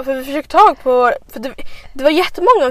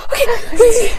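put-on drunk friend voice; 9 semitones higher, 4 dB louder than usual.

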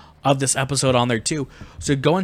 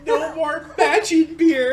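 high-pitched laughing through tears; laugh-crying, barely getting words out; hysterical laughing; 6 dB louder, much slower than usual. Real, not acted. Hysterical over lost take.

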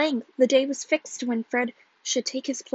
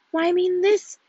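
higher, eager reveal; excited; 7 semitones higher, 4 dB louder than usual. A narrator's reading. Husband showing his find, excited. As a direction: present the proof triumphantly. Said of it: "his eyes speaking with excitement".

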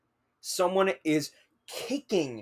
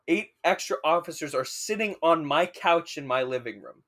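ordinary delivery

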